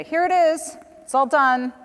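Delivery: mocking someone who hands the lawyer a finished video; mock insistence. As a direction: ham up the pushy, done-deal presenter.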